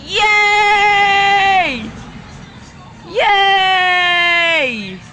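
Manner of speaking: loud high cheer; joyful yay. Real, not acted. Gamer cheering as the food is ready, loud high yay. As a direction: celebrate the finished soup.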